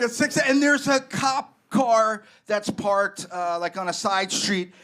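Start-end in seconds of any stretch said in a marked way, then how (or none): none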